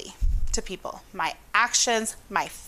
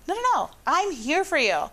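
eager reassurance; 7 semitones higher than usual. Acted. Teacher reassuring students.

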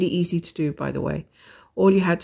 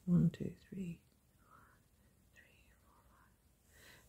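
whispered counting, fading to a whisper; counting under breath; 15 dB quieter, slower than usual. Real, not acted. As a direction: count quietly to yourself while stitching. Counting stitches under her breath.

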